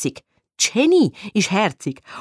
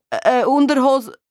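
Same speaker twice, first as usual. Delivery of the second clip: high, startled stammering; caught-out stutter; flustered stammer; 5 semitones higher, 3 dB louder than usual. A narrator's reading. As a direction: trail off embarrassed mid-word. Embarrassed before unexpected guest.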